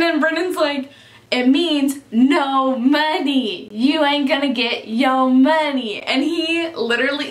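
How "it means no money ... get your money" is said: slow, drawn-out words; imitating a blunt, deliberate put-down; retold emphatic explanation. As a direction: spell it out slowly for someone not getting it. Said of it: "the way he said it".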